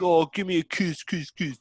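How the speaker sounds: high, playful begging for kisses; smitten cat-owner impression. Fan imitating man after his cat, high mock pleading. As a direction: plead in a put-on gushing voice.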